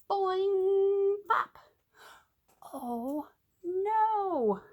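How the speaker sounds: usual speaking voice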